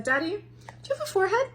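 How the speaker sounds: put-on high childlike question to bald daddy; little son voice. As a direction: ask it like a curious toddler. Small son asks bald dad.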